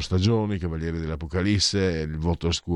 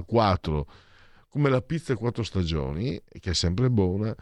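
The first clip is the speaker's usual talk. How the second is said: usual speaking voice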